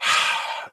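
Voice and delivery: long breathy sigh; weary sigh. Retold or imitated. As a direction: sigh it out, fed up. Weary sigh while deliberating rating.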